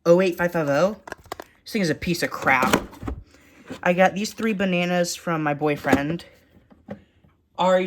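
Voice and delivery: ordinary talk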